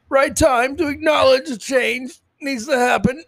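mock weepy executive. Mocking quoted corporate statement.

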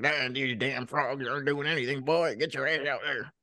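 mock Southern old-man impression; old man's Deep South accent. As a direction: play a cantankerous old Southerner ordering a boy around. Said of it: "Deep South racist old man".

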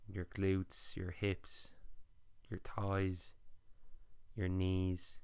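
calm body-scan guidance with long pauses; guided meditation. Acted.

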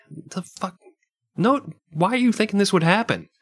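high, disbelieving outburst; can't believe what you're hearing; incredulous. Real, not acted. Incredulous reaction to advice.

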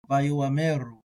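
Vayoameru with a small a sound after the o; aleph pronounced. Shown as a demonstration. Teacher demos his preferred reading with aleph. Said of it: "Vayoameru is how I would say it".

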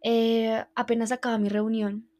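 usual speaking voice

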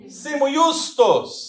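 "Simu justus" slowly, high and loud; Latin pronunciation demo. Shown as a demonstration. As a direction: model "Simu justus" for the class to repeat. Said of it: "Fala assim, ó". ("Simu justus" lento, agudo e alto, ensinando)

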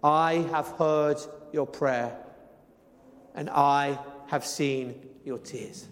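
tender scripture reading. Acted. Preacher reading God's promise to the weeping faithful, slow and tender. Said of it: "some of the most beautiful words of Scripture".

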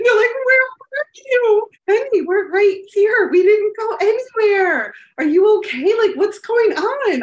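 high, loud and worried; panicked mother calling to her children; frantic high mom voice. Retold or imitated. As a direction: call through the door to scared kids. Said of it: "They're like".

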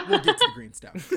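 mock cartoon squeak; squeaky high voice. Repeats line in silly voice.

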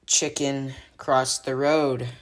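drawn-out, deliberate delivery; slow punchline. Real, not acted. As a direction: stretch out each word.

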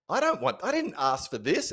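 high, whiny child's protest, imitated; upset four-year-old. Four-year-old daughter rejecting grandma's present, high and whiny. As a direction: pout it out like a cross little girl. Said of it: "straight away she goes".

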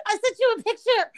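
high-pitched, breathless blurting; frantic high confession; panicked confession. Retold or imitated. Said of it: "like, hyperventilating".

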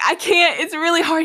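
exasperated whine, replayed; retold straining protest. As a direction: plead that it's too hard, acting it out. Retold struggle pulling the locked wheel.

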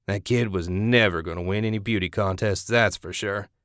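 usual speaking voice

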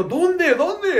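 high, startled repeated question; mock panic. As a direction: play the scared driver searching. Panicked reply to cop.

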